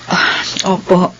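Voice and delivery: sighing. Acted. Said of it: "bakit bumuntong hininga ka".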